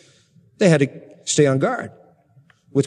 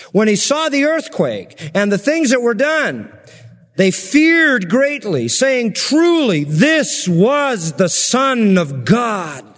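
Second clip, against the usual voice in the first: awed reading; 4 semitones higher, slower than usual. Real, not acted. Preacher reads the centurion's cry, slow and awed. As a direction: draw out the confession in wonder.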